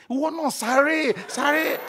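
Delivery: high, loud cries urging the animal on; hammed-up frantic cheering; mock frantic viewer. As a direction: yell at the screen in mock panic. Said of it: "that's how some of you are".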